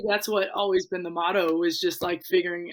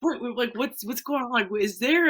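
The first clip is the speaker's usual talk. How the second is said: confused concern; 6 semitones higher than usual. Retold or imitated. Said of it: "I was like".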